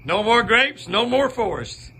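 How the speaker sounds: high, laughing voice for the deer; voicing the deer, laughing. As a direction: speak for the deer through a laugh. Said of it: "He said".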